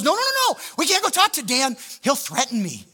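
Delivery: high, loud mock alarm; panicked refusal. Staff member refuses to go talk to Dan, panicked. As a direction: blurt it out in acted panic.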